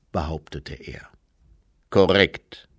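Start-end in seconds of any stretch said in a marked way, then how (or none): 1.9-2.7 Herr Weiss. Acted.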